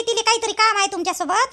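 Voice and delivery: ordinary talk, nothing marked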